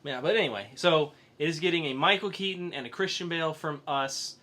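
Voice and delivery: usual speaking voice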